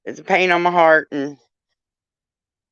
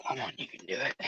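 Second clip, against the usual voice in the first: soft coaxing; 8 semitones lower, 17 dB quieter, faster than usual. Real, not acted. Coaxing the dog.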